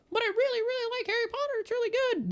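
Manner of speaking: very high, squeaky mocking falsetto; imagined whiny fan. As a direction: mimic a Harry Potter fan in a silly, tiny high voice. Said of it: "that's how I hear it in my head".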